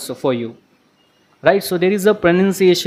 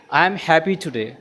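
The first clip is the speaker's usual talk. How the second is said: ordinary talk, nothing marked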